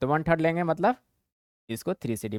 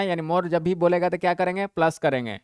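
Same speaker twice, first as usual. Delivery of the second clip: normal talking voice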